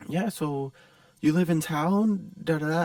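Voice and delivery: high, probing mock voice; skittish stranger. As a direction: imitate a nervous guy fishing with questions. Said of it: "he starts asking weirder questions".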